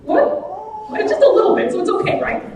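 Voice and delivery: high, playful feigned innocence; mock innocent defense. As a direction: play dumb, brightly, to the crowd. Mock defense of poop cookie.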